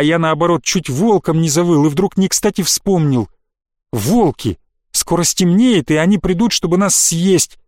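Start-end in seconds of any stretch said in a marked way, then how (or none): none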